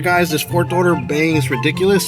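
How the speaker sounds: ordinary talk, nothing marked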